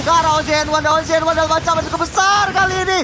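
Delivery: high, loud hype play-by-play; fighting game announcer. Acted. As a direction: call the fight at full hype. Caster hyping a teamfight.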